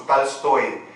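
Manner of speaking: "Tolstói" said with a for the first o; "Tolstói" Russian-style, unstressed o turned to a; Russian pronunciation. Shown as a demonstration. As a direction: say "Tolstói" as "Talstóy". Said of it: "ele é pronunciado como A".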